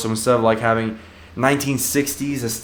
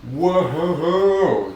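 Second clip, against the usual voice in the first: excited whoa; 5 semitones higher than usual. Real, not acted. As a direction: cry out with delight while spinning. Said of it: "I'm spinning in a chair".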